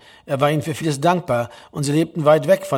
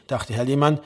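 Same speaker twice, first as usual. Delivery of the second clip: ordinary delivery, nothing marked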